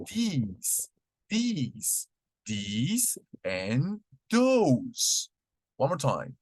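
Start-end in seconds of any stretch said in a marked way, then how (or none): 0.1-2.1 "these" drawn out long and soft, pitch rising. Shown as a demonstration. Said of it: "es más prolongado". 2.4-5.3 "these and those" stretched very slowly; very slow drawn-out model. Shown as a demonstration. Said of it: "es más prolongado".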